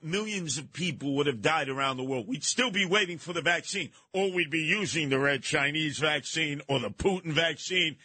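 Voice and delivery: usual speaking voice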